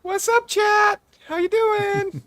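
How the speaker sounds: put-on chirpy anime girl greeting chat; cute anime streamer. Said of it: "I could just try to do, like, a voice".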